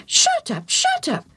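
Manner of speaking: very high parrot squawk; Bobo the parrot. Acted. Parrot interrupts the priest's kind words, squawking. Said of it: "the parrot said again".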